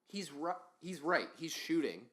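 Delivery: normal talking voice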